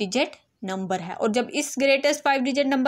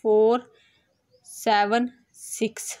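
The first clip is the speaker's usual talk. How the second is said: slow, digit by digit from the left; reading digits one by one; slower than usual. Shown as a demonstration. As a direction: count out each digit slowly for the class. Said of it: "from extreme left". (अंकों को एक-एक करके धीरे पढ़ना)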